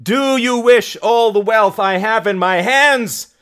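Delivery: high, loud demand to a crowd; shout. Acted. Mary pressing the crowd to choose, high loud shout. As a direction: shout for one united answer. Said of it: "shouted Mary".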